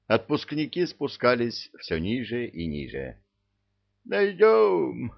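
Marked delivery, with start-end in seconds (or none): 4.0-5.1 high, eager promise; reassuring devil. A narrator's reading. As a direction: cheer a friend on, high and bright. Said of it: "обнадёжил нечистый". (ободряющий возглас)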